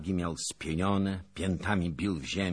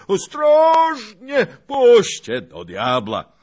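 loud bellowing, swelling into long cries; shouting with all might; 7 semitones higher, 14 dB louder than usual. A narrator's reading. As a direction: holler indignantly at those carrying you. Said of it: "krzyczał co siły".

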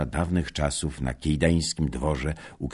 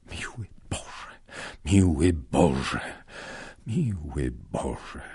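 grieving lament; slower than usual. Acted. Grieving, shaking his head.

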